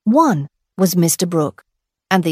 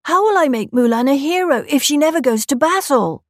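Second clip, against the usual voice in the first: higher, fast little dragon voice; 6 semitones higher, faster than usual. Acted. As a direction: rattle it off like an excited sidekick. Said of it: "said Mushu".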